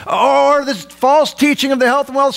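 high-pitched pulpit denunciation; forceful preaching. Real, not acted. Denounces false teaching.

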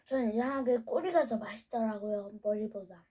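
speaking between bites; chewing while talking. Real, not acted. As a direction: mouth partly full of pastry. Seen 1: Holds fish pastry, mouth working while talking, bites again near end.

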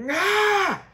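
imitated high, loud yell of frustration; short, high-pitched frustrated "ah". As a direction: let out a mock exasperated scream. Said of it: "kind of yell he does".

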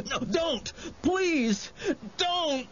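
very high, panicked sobbing cry; sobbing plea. A narrator's reading. Wendell flees from his winged attacker, sobbing. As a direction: beg tearfully while fleeing for your life. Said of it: "Wendell was sobbing as he ran".

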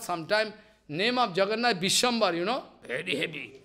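ordinary delivery

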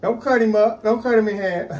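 high, loud teasing demand; playful demanding. Acted. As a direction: beg loudly, half laughing. Playfully demanding wife's shrimp.

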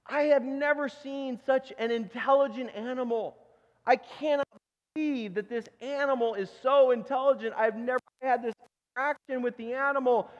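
high-pitched, hammed-up astonishment; acted urgent, amazed protest; alarmed butcher interrupting. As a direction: burst in to stop a scolding. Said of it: "jumps out from behind the corner and says".

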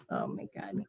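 breathless whispered aside. Real, not acted. Streamer overwhelmed by viewer gifts, whispering. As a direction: breathe it out under your voice.

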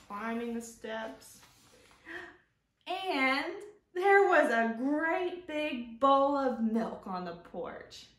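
slow, expressive picture-book narration; storybook reading. Acted. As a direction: read aloud warmly to young kids.

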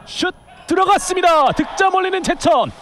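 high, loud shouted goal call; excited goal call. Real, not acted. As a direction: belt out the goal. Live goal call in futsal.

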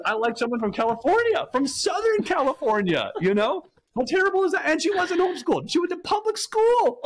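high-pitched, hammed-up indignation; mock outrage. Husband retells learning wife is Californian, mock outrage. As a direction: exclaim it high, as if scandalized.

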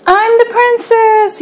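high-pitched proud yell; excited yell. A narrator's reading. Jojo announcing her part as the princess, yelling. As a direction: call it out high, showing off. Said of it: "yelled Jojo".

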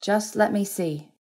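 pressing plea. A narrator's reading. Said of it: "she pressed".

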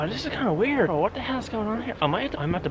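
quiet, high, puzzled; half-whispered, creeped out; hushed surprise. Real, not acted. Finds himself behind someone's house.

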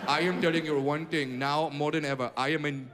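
mock Indian accent, pleading for tech help; a desperate Indian-accented caller begging for help; Indian caller impression.

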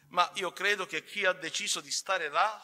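usual speaking voice

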